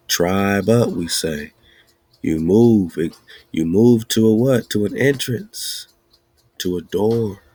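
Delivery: ordinary talk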